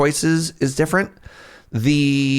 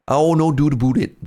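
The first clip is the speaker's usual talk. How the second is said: put-on rural prairie Canadian accent; 5 semitones higher, 3 dB louder than usual. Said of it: "it almost sounds like Minnesota".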